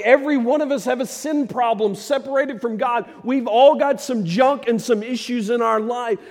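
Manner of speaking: loud, high, driving sermon delivery; a preacher pushing the crowd, loud and high; full-force preaching. Acted. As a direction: preach it hard to the congregation.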